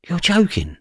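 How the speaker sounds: high-pitched retold disbelief. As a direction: react as if you can't believe it. Retells disbelief at the news.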